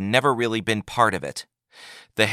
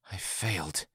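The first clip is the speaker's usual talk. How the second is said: much softer muttered shame; 13 dB quieter than usual. A narrator's reading. Ashamed Yagar mutters to the Advocate General. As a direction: mutter it to the ground. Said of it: "he muttered".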